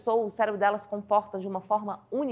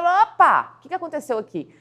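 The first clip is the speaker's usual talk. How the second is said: startled reaction; 5 semitones higher, 7 dB louder than usual. Pretend. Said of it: "a pessoa vai ter".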